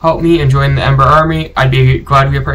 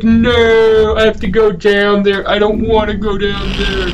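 higher, whiny moaned protest; high-pitched, dragged-out mock whine; mock moaning; 6 semitones higher than usual. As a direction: imitate yourself moaning in reluctance. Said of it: "moaning. I was like".

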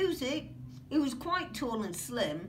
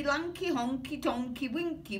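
ordinary delivery

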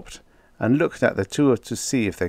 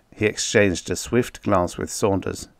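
ordinary delivery

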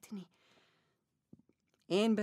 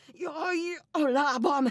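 pained cry; 7 semitones higher, 6 dB louder, slower than usual. Acted. Sudden pain in her foot.

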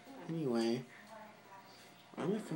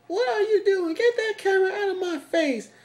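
high, loud angry spider rant; a tiny furious spider yelling at the camera; 9 dB louder than usual. Pretend. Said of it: "She's like".

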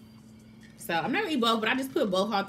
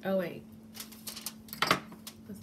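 ordinary talk

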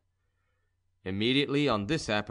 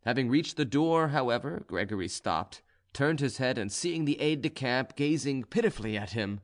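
ordinary delivery

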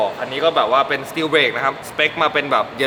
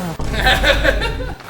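laughing; 12 semitones higher than usual. Real, not acted. Laughs while praising engine.

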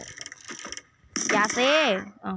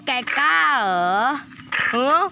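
slow, drawn-out and louder "แกกล้าเหรอ", rising at the end; slow mock dare; 3 semitones higher, 4 dB louder, slower than usual. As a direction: stretch every syllable as a hammed-up dare. Taunting mobs in the game.

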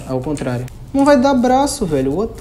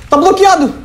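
high yell with a nervous laugh; panicked laughing yell; 12 semitones higher than usual. Real, not acted. Trapped gamer yelling, high panicked laugh.

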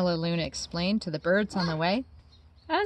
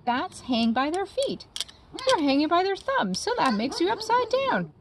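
high, puzzled creature voice; lightly voiced curious creature; puzzled bird creature; 9 semitones higher than usual. Creature talking to Stella Luna.

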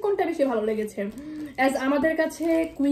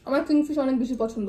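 chewing while talking; speaking around a bite of food; mouth full. Real, not acted. Seen 0: Woman holds burger, lips pursed and moving, mouth seems to hold food.

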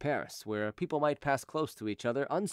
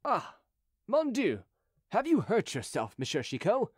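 high-pitched startled exclamation; startled concern; 7 semitones higher than usual. Acted. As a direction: react with alarmed concern for him. Startled soldier sees Chicot fall.

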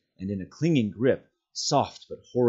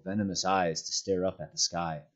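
plain reading voice

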